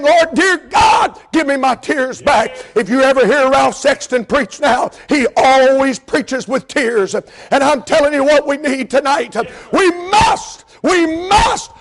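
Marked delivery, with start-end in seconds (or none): 0.0-2.6 preacher acting out a desperate plea; shouted pleading prayer. Retold desperate begging prayer. 7.2-11.7 much higher, shouted, slow exhortation; full-force preaching. Acted. Preaching climax to congregation.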